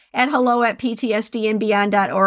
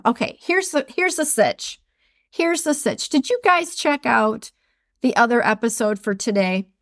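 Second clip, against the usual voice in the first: ordinary talk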